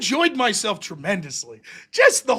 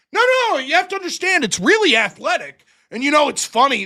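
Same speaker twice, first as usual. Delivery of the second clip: high, loud, insistent defense of wrestling; mock earnest pleading; 6 semitones higher, 5 dB louder than usual. Fan defending wrestling to a skeptic, high and pleading. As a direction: plead like a fan nobody gets.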